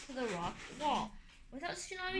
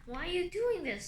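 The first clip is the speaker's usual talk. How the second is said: high, loud pleading outburst; distressed cry; 6 semitones higher, 6 dB louder than usual. A narrator's reading. Sunny begging the guardians to stop, crying out. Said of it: "Sunny cried".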